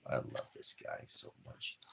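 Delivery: low tender whisper, "I" leaned on; soft whisper. Real, not acted. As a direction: breathe it to the dog with love. Tender words to his puppy.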